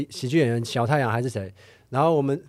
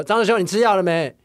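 usual speaking voice

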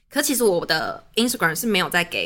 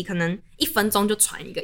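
ordinary talk

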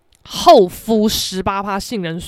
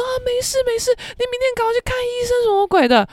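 imitating reassuring friend; 13 semitones higher than usual. Friend soothes her on phone.

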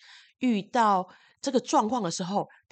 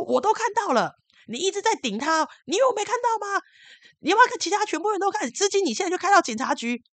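high, loud angry confrontation; furious accusation, fast and high; scolding loudly; 12 semitones higher, 6 dB louder than usual. Retold or imitated. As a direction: call him out in front of everyone. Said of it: "我就大骂".

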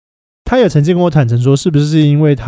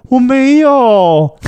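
drawn-out high mock protest, much slower; mock brother denial; 11 semitones higher, 5 dB louder, much slower than usual. Teasing older brother mock-denies it to his mom, slow and high. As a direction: stretch the denial out slowly in a higher, louder put-on voice.

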